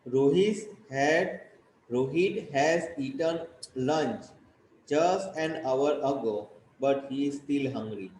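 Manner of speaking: very slow, word by word English dictation. Shown as a demonstration. Teacher dictating the sentence slowly to the class. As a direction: read each word out slowly for copying. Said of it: "teacher dictating English sentence".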